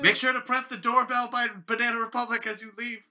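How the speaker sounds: calling out. Acted. Clerk calls to customer leaving the store, calling out. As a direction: holler it to someone on their way out.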